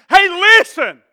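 high, loud demand to be heard; blunt shout. Acted. Acts getting real with God.

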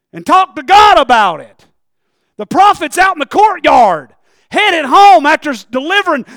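loud storytelling sermon; preaching at full force. Real, not acted. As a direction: shout the Hezekiah story to the congregation.

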